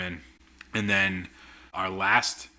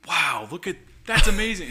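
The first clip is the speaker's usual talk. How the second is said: higher-pitched, louder mock-amazed reaction; imitated amazement; 8 semitones higher, 8 dB louder than usual. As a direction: act out a delighted cast member. Retelling cast member's delighted reaction.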